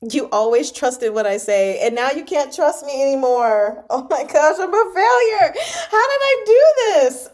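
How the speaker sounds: building to high, loud laugh-crying; distraught wail. Real, not acted. Reader confessing failure to trusting readers, wailing.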